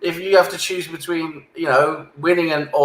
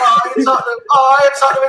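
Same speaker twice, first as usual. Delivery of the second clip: teasing; 8 semitones higher, 5 dB louder than usual. Real, not acted. Teasing rival fan.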